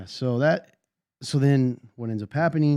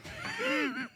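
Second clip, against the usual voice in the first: high burst of laughter; 10 dB quieter than usual. Real, not acted. Laughs at own cough joke.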